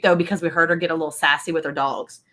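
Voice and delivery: usual speaking voice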